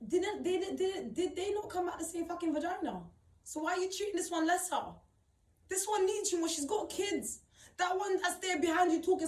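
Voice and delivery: raging at her mother's unfairness, loud and high; angry outburst. Real, not acted. Furious rant at her mum.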